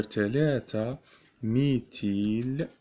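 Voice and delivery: ميتيل drawn out very slowly; slow dictation. Shown as a demonstration. Teacher dictating to students, ميتيل stretched out. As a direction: stretch it so they can write it.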